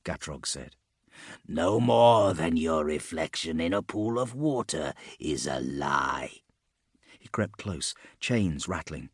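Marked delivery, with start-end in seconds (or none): 1.5-6.4 slow, insinuating villain voice; sinister Rotbringer. A narrator's reading. Rotbringer contradicting a tormented man, sinister. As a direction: draw it out, sinking into a whisper.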